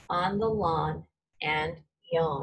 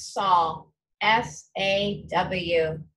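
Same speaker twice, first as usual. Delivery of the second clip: slow spelling; much slower than usual. Shown as a demonstration. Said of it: "teacher spells slowly".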